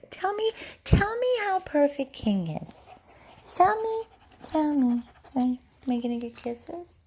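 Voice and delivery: sweet, high pet voice; high-pitched dog talk. Real, not acted. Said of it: "He loves when I talk all high pitch".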